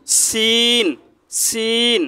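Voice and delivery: "সিন" with a thin, sharp s, drawn out; som fino. Shown as a demonstration. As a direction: hold "সিন" on a fine s. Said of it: "চিকন করে উচ্চারণ করবেন".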